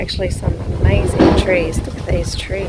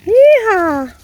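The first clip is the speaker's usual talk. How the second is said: high, loud yeehaw; hollering like a cowboy; 11 semitones higher, 11 dB louder than usual. Real, not acted. Cheering on the quad.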